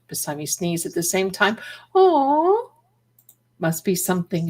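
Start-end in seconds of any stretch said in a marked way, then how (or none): 1.9-2.7 high, loud cooing aw. Real, not acted. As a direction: coo at the kitty. Cat sneezed.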